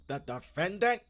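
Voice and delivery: a mocking shocked echo; mock incredulous. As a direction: imitate him echoing it in disbelief. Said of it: "And Darrell Brooks like".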